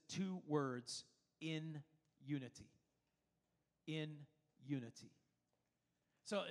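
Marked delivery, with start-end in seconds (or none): 1.4-2.7 "in", a pause, then "unity" falling lower; weighty, separated "in ... unity"; emphasis on IN UNITY. Shown as a demonstration. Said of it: "the emphasis that David brings". 3.9-5.1 "In", a pause, then "unity" dropping lower; emphasis on IN UNITY. Shown as a demonstration. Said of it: "the emphasis that David brings".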